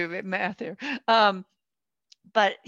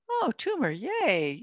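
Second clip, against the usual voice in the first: mock delight; 5 semitones higher, slower than usual. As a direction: pretend to welcome awful news cheerily. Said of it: "very few of us would go".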